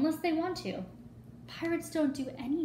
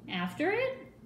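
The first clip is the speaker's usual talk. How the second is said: ordinary delivery, nothing marked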